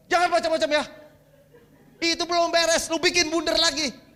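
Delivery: loud, high mock scolding; angry wife. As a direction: imitate a wife telling her husband off. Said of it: "Marah dia".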